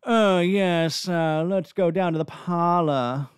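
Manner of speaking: exaggerated underbite, nasal posh British; stuck-up British accent. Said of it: "over-exaggerate the underbite and then nasal it a bit".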